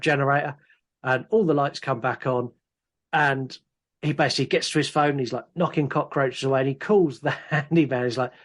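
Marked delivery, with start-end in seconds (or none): none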